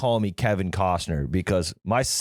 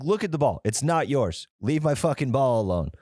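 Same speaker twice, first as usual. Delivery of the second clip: higher-pitched, indignant retelling; retold heated protest; 6 semitones higher than usual. Golfer telling a stranger off his ball, heated. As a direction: act out getting heated without yelling. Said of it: "you got heated".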